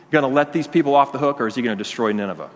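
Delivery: usual speaking voice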